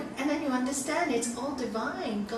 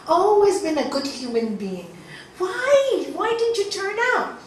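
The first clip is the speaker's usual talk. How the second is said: hammed-up wail to God, rising higher and louder; anguished crying complaint; 6 semitones higher, 7 dB louder than usual. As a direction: plead in hurt, building to a loud high cry. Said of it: "he goes to his prayer room crying".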